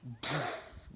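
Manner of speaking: short burst of air for a drop; imitated sudden impact. Demon dropping onto the window, imitated impact burst. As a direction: puff out a quick crash noise.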